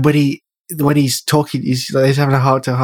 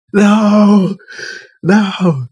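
loud, high mock wail of "No!"; imitated anguished scream; 10 semitones higher, 4 dB louder than usual. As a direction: howl it like the tragic final scene, hammed up. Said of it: "That's what I was like".

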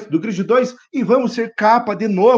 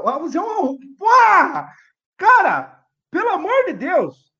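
high angry yell; frustrated shout; 8 semitones higher, slower than usual. Real, not acted. Game crashed, shouting frustration.

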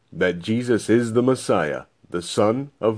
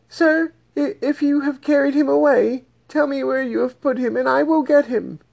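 much higher voice, begging the gardener; Mary pleading. Acted. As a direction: voice Mary high, pleading for the body.

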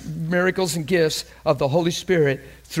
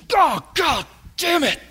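loud, high acted outburst, slowly stretched; acted yell of frustration; frustrated cursing; 13 semitones higher, 4 dB louder than usual. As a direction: curse like you just hit your thumb. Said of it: "I used it with emotion and expression".

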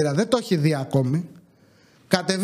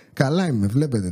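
laughing; 6 semitones lower than usual. Real, not acted.

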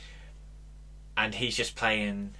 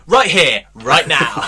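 loud, high announcer shout; 6 semitones higher, 15 dB louder, slower than usual. Retold or imitated. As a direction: blast it like a dance track hook. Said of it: "he always says".

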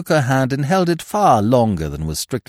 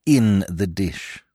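plain reading voice

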